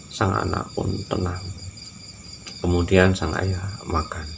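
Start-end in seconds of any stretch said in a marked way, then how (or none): none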